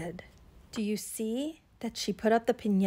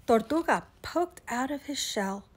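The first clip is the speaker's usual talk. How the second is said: ordinary delivery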